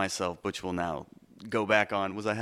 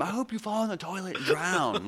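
mock berating; 9 semitones higher than usual. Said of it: "I would, like, berate them".

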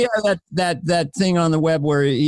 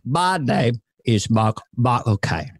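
slow, low Cockney impression; Michael Caine; 4 semitones lower, slower than usual. Shown as a demonstration. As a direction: spell out the Cockney sounds slowly. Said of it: "think of it phonetically".

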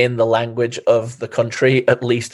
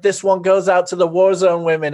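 higher, lightly projected stage announcement; retold stage dedication; 7 semitones higher than usual. Singer dedicating a song near the end, retold stage call. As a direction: dedicate the song to the crowd, pitched up. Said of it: "I'm like".

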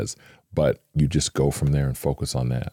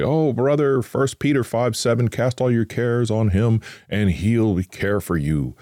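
mocking a glib believer quoting scripture; glib brother; 7 semitones higher than usual. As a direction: play the smug church friend tossing off a verse. Mock glib scripture-quoter.

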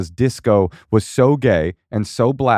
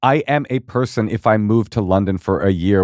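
usual speaking voice

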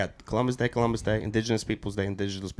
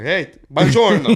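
mock tourist greeting; 7 semitones higher, 5 dB louder than usual. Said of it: "he's like".

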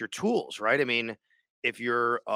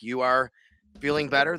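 ordinary talk, nothing marked

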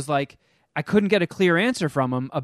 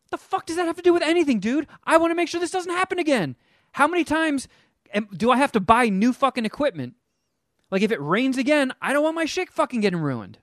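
much higher, fast frustrated rant; heated retold rant; exasperated outburst; 9 semitones higher than usual. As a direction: vent it fast and high. Retold heated argument reply.

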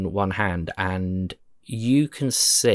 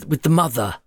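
a hint of a higher voice speaking into the mind; a mild telepathic voice, a little higher and halting; a hint of a higher voice as Nettles describes the kidnapper; lightly voiced Nettles; 6 semitones higher than usual. Nettles answers in the head.

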